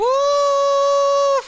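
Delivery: loud high whoop of joy; excited whoop. Real, not acted. Cheering the coach firing with a loud whoop. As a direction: let out a big triumphant woo.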